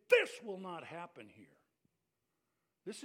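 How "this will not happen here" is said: "This will not happen here" said louder, finger pointed; stern, loud insistence; pointed warning. Retold or imitated. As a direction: lay down the law. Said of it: "With a finger pointed and saying".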